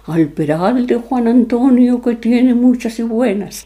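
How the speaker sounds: slow, lightly voiced ghostly chant; hint of a lamenting soul, drawn out. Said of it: "fingió la voz aún más".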